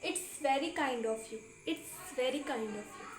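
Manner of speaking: very slow, each word laid out for learners; slow teaching read. Shown as a demonstration. Teacher reading an English phrase to students, very slow. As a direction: spell it out slowly so students can repeat. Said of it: "English में क्या बोलोगे".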